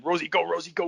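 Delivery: high, pressing mock coach call; retold urgent command. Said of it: "He just started kicking me, saying".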